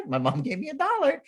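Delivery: put-on high-pitched child voice; imitated little girl. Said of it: "the girl's like".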